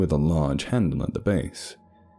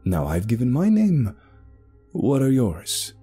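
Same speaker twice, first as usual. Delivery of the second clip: raised pitch, pressing for an answer; pointed questioning; 7 semitones higher than usual. Acted. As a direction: press them for their names. Sebastian demands their names.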